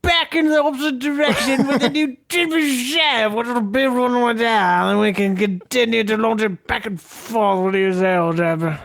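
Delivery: put-on thickening British accent. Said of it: "He just gets more British as he goes".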